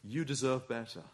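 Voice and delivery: soft, low insinuation; hushed, subtle coaxing; little tempting voice. Acted. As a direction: murmur it like a tempter at the ear. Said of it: "is the little voice which says".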